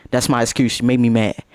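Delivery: normal talking voice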